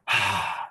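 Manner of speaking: long airy exhale sigh; breath sigh. Shown as a demonstration. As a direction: sigh out like fogging a mirror. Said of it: "which simply goes like this".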